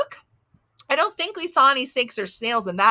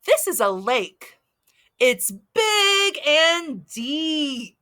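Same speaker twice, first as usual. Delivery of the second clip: very slow, deliberate storytime reading; reading to a toddler; 3 semitones higher, much slower than usual. Acted. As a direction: stretch every word for little kids. Reads picture book slowly.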